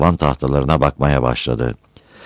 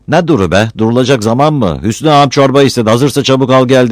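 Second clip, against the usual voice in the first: loud, impatient shouting through the door; loud, pushy shouting, fast at the end; 8 semitones higher, 6 dB louder than usual. Acted. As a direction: yell it from out in the cold. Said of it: "Battal öyle bir bağırıyordu ki".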